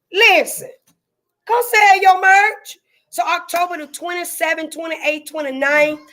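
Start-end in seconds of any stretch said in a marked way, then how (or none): 0.1-2.8 high, loud and drawn out; excited pitch. Real, not acted. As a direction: call the vendors in with relish. Pitching vendors on live.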